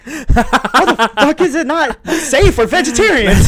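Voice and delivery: high, slow incredulous laugh-talk. Real, not acted.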